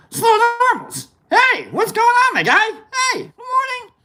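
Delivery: playful falsetto hello, very high; mock-excited falsetto shout-out; squeaky greeting. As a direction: greet them in a silly sky-high voice.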